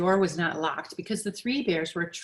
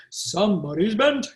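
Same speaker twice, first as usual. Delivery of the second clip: a louder, lightly voiced big bear; great big bear voice; 4 semitones higher, 6 dB louder than usual. Great big bear, finding his spoon in the bowl, lightly voiced and loud. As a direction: growl out the big bear's complaint, big and loud. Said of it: "in his great big voice".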